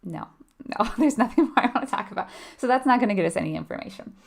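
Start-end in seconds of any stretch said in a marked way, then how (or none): 0.0-2.3 louder, higher, quick brush-off; light acted refusal, hurried; mock client refusal. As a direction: play a client shutting the question down, half-laughing. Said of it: "They're gonna be like".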